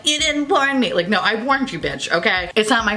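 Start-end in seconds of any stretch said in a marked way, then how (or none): 0.0-0.9 whiny commenter. Retold or imitated. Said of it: "assholes in the comments being like".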